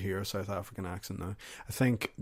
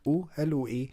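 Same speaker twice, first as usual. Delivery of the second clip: raised-pitch mock South African accent; attempted South African accent; 5 semitones higher than usual. Shown as a demonstration. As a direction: have a poor stab at South African. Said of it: "what does South African accent sound like again?".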